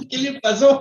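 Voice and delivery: laughing quote. Retold or imitated. Others asking, laughing.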